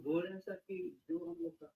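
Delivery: very soft, barely audible caller; too quiet. Real, not acted. Caller starting his question to the panel, too quiet. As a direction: speak far too softly to be heard. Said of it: "Can you be loud".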